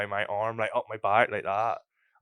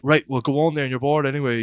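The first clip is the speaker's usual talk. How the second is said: louder, higher mock-bouncer dismissal; brusque dismissal; 6 semitones higher, 7 dB louder than usual. Bouncer barring a teenager, brusque raised voice. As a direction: send them off, voice raised.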